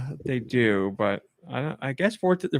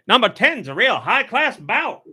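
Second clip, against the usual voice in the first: put-on higher old-timey announcer voice; old newsreel narrator; 8 semitones higher than usual. Shown as a demonstration. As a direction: call it like a vintage fight announcer. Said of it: "The old-timey voice helped".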